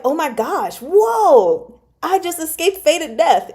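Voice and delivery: high, excited gasp of relief. Retold or imitated. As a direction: burst out with sudden relief.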